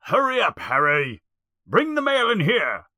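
high, called-out summons without real volume; mock shout. A narrator's reading. Uncle Vernon orders Harry to bring the mail, mock shout. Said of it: "shouts Uncle Vernon".

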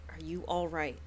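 soft, low, concerned question. Acted. Solomon asking his wife if she is all right, soft and low. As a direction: gently check on her. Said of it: "Solomon was like".

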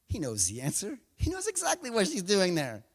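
ordinary talk, nothing marked

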